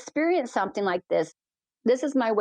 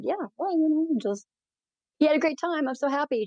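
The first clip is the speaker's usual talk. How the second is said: raised pitch, mildly bubbly retold reassurance; happy reply; 6 semitones higher than usual. Pilot reassuring a rider's mother, happy reply. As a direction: lightly act out a pleased, upbeat answer.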